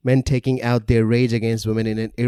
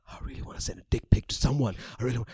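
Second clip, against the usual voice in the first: whispered creep voice; 7 dB quieter, much faster than usual. Acted. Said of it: "I just say".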